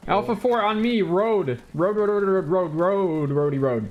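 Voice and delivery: rally the team to you; rallying shout. Real, not acted. Squad leader rallying team.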